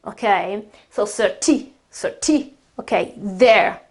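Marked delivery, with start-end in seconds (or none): 1.0-2.5 "certi" with the accent on "ti"; stress on TI. Shown as a demonstration. Teacher isolates the accented vowel, "cer-TI". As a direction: say "certi" leaning on its second syllable. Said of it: "I have to put the accent".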